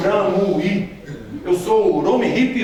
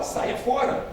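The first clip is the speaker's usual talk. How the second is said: whispered dismissal; 5 semitones higher, 7 dB quieter, slower than usual. Acted. Answers each warning sign.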